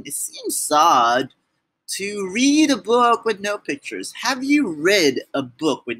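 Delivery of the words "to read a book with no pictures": ordinary delivery, nothing marked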